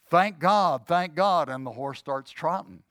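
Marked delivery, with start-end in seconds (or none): 0.1-1.5 two louder calls of thank God; louder command. Retold or imitated. Rider urges the horse faster, calling louder. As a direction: push it out louder to speed the horse up. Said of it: "he says louder".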